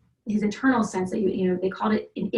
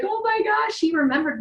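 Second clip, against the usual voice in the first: much higher, emotional gasp; retold tearful amazement, much higher; overwhelmed joy; 11 semitones higher, 3 dB louder than usual. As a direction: burst out with teary joy. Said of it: "I'm like".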